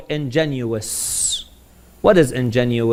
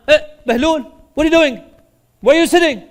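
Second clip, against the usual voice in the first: high, raised-voice guard's challenge; alarmed challenge; 11 semitones higher, 4 dB louder, faster than usual. Retold or imitated. Guards catching Bahlul sitting on the throne, high alarmed challenge. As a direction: call out sharply to an intruder, pitched high.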